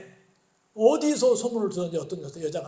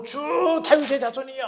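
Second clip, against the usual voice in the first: raised, pleading cry to the Lord; 6 semitones higher, 5 dB louder than usual. Retold or imitated. Canaanite woman calls out to Jesus, raised pleading cry. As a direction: call out to him, higher and louder. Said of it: "하고 외치면서".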